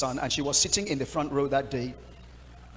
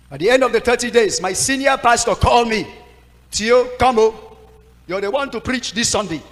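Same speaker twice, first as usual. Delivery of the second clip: loud, raised summons; urgent call; 7 semitones higher, 11 dB louder than usual. Retold or imitated. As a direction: call the young pastor in, loud. Senior pastor calls him urgently.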